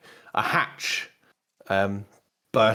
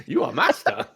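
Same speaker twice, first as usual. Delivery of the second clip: higher-pitched droid servant; servile droid; 7 semitones higher than usual. Shown as a demonstration. GM shows the robot voice to players, servile droid. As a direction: do a high little servant creature.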